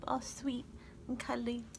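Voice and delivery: normal talking voice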